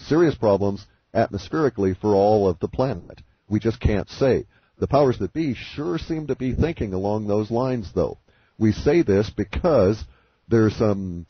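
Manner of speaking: ordinary delivery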